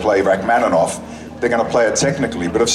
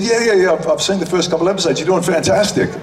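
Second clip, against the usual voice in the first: cheery praise; 9 semitones higher than usual. Retold or imitated. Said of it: "And he said".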